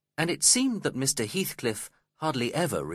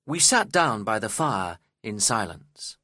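ordinary delivery, nothing marked

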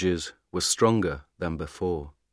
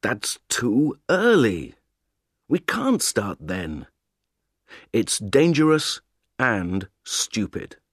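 angry; 4 semitones higher than usual. Acted. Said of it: "He looked angry and unhappy".

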